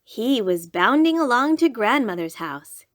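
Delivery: ordinary delivery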